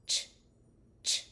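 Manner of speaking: repeated ch with pursed lips; ch sound. Shown as a demonstration. Therapist repeating ch for the class. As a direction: model the ch sound. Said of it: "which is ch".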